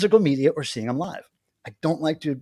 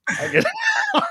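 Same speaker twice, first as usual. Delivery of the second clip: much higher, squeaky laugh through the words; much slower than usual. Real, not acted. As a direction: crack up on the repeat. Repeats promise in high laugh.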